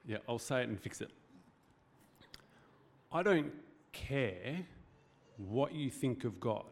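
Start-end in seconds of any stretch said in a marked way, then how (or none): 3.1-6.6 very slow, every word weighed; slow it right down so it lands; slow and deliberate. Shown as a demonstration. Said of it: "Hear my heart in this".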